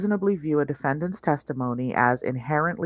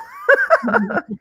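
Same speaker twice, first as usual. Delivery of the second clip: loud high laugh bursting out; shrieking laugh; burst of laughter; 8 dB louder than usual. Real, not acted. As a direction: crack up loudly. Laughing at the quoted emphasis.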